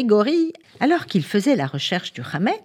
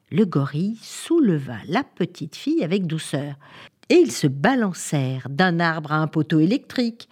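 ordinary delivery, nothing marked

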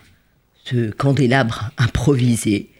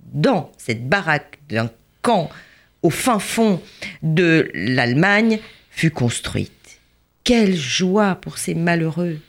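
plain reading voice

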